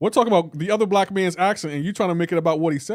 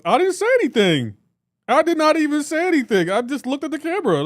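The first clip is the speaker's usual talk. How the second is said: higher and louder, all innocence; mock innocent protest; 10 semitones higher, 5 dB louder than usual. Real, not acted. Streamer defending himself to Queen Misty, high indignant protest. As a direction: play the wronged innocent.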